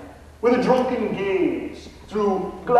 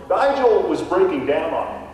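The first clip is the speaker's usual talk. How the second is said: ordinary talk, nothing marked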